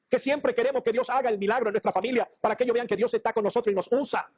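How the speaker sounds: fervent pulpit exhortation; preaching. Acted.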